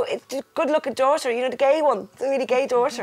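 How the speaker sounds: high-pitched, cheeky phone greeting; hammed-up teasing introduction; playful self-announcement.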